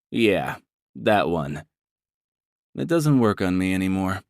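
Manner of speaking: usual speaking voice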